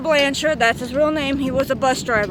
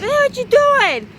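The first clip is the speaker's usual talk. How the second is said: high, slow alarmed shout; alarmed call; 10 semitones higher, 4 dB louder, slower than usual. Retold or imitated. Kid starts driving the bus.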